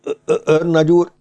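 high, hesitant stuttering; halting, intimidated address; frightened stammer. A narrator's reading. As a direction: stammer nervously before a furious superior. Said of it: "X L őrmester halálsápadt volt".

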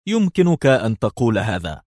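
ordinary delivery, nothing marked

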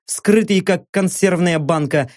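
ordinary delivery

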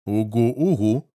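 slow mumbled uh-huh, chewing; mumbling with mouth full. A narrator's reading. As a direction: hum it through a mouthful. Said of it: "мычит Буги-Вуги". (мычит с набитым ртом)

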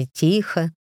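plain reading voice

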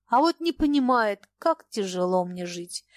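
usual speaking voice

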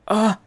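loud high cry of fright; frightened cry. Acted. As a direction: cry out in sudden fear. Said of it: "раздался испуганный крик".

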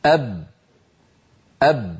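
أب with lips sealed, sound fully stopped; held b demo. Shown as a demonstration. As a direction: say أب and hold the closed lips. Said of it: "جربوا هكذا". (نطق أب بانحباس الصوت)

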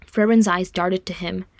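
usual speaking voice

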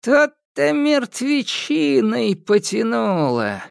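high slow witch voice, called out; old witch sniffing the air aloud; loud old witch. Acted. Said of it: "громко сказала Яга". (громкий голос старой ведьмы)

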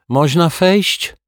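high, drawn-out Turk character voice; Turkish visitor. Acted.

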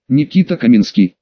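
ordinary delivery, nothing marked

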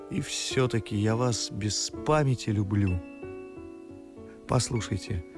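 low, earnest love confession; tender confession. Acted. Suitor confessing love to a cold woman, low and tender. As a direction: drop the pitch and confess sincerely.

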